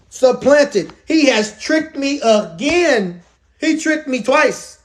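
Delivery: high, drawn-out mock outrage; indignant complaint. Esau to his father about being tricked, indignant. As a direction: spell out the grievance with rising indignation.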